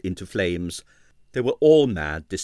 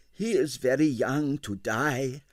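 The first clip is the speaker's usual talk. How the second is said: sad; 3 semitones higher, 3 dB quieter, slower than usual. A narrator's reading. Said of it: "he said sadly".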